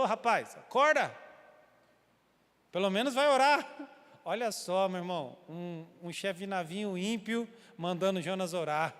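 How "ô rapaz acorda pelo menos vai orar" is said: higher, louder shout to wake him; waking shout. Retold or imitated. Captain jabbing Jonah's ribs to wake him, shouting. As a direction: yell at the sleeper to get up and pray.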